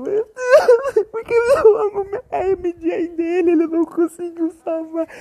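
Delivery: high, tearful cry with a laugh breaking in; real tears over a film scene. Real, not acted. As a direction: choke up, half laughing. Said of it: "eu tô quase chorando".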